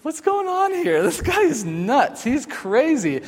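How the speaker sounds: high bewildered take on "This guy is nuts"; incredulous inner voice. Retold or imitated. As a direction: play your younger self, baffled and high-pitched. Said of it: "just thinking".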